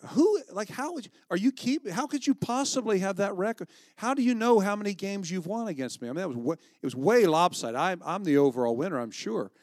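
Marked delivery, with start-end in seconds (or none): 0.1-6.1 high, loud disbelief; who, how could you, pitched way up; mock outrage, much higher and louder; incredulous. Incredulous retort about son's record.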